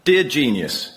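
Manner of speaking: ordinary talk, nothing marked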